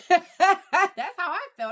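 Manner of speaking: high, squeezed words through laughter; real laughing voice. Real, not acted. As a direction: say it while cracking up. Laughing at listeners' shocked reaction.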